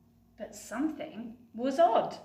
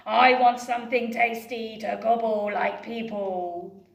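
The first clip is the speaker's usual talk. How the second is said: dragon voice; 8 dB louder than usual. Acted. Dragon speaking.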